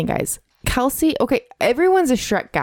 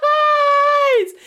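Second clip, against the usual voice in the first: laughing shout. Acted. Calling out the Noise Boys.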